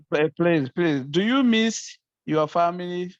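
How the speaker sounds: ordinary delivery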